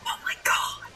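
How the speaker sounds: hushed, barely voiced aside; stunned whisper. Retelling her own shock to viewers, whispered in disbelief.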